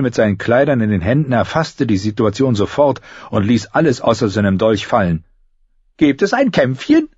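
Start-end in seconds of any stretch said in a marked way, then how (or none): 6.0-7.1 high-pitched, keen character voice; eager questioner. A narrator's reading. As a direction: ask in a raised voice, keen for a scrap. Newcomer eagerly asks about a fight.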